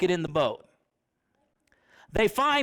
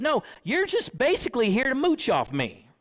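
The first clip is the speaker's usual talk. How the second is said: blunt paraphrase; 6 semitones higher than usual. Retold or imitated. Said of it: "That's the Raymond version".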